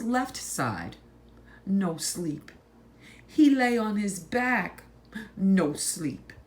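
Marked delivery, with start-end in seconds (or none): none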